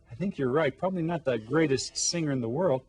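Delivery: usual speaking voice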